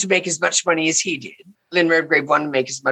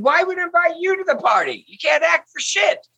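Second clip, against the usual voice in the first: high-pitched staged-fight outburst; retold stagey shouting, pitched way up; loud theater voice; 14 semitones higher than usual. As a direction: hurl a mock insult for the eavesdroppers. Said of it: "we were really loud, using our theater voices".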